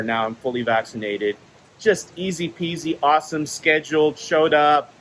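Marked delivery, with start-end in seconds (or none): none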